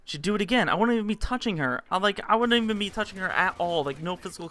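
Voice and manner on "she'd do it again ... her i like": ordinary talk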